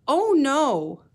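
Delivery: a small "oh no" from a worried penguin; little penguin. Acted. Teacher reading, voicing little penguin's dismay.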